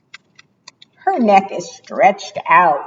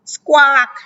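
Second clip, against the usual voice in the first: squawk; 7 semitones higher than usual. A narrator's reading. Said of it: "a very loud squawk".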